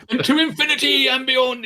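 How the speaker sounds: Brian Blessed impression. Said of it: "he used to say".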